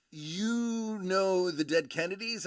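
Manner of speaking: imitating an astonished new friend; retold amazement. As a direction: act out her slow disbelief. Friend reacts amazed he knows band.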